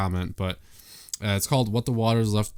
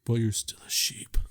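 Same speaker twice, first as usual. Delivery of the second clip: whispered tease; 3 dB quieter than usual. Real, not acted. Teasing aside to cohost.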